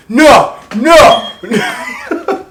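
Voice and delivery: high, loud stunned refusal; a father hearing shocking news, yelling no; dad's shocked no. Retold or imitated. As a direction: shout no in disbelief, cracking high. Dad shocked by pregnancy news.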